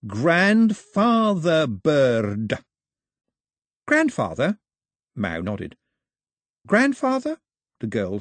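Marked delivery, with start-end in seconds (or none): 0.0-2.6 very slow, higher, louder; baby talk voice. A narrator's reading. Mao answering Daphne in a talking-to-baby voice. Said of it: "in a talking to baby's voice". 3.9-4.6 puzzled question. A narrator's reading. Daphne puzzled repeating. 6.7-7.4 much higher, rising; bewildered question. A narrator's reading. Daphne puzzled by Mao's word, high and rising.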